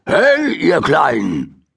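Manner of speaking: slow friendly call from the window; a hint of a called greeting. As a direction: call gently to the rabbits. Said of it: "rief Mister Morrison aus dem Fenster".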